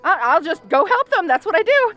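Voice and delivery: high, tense excuses to slip away; nervous bravado. Acted. Fleeing a distress call nervously.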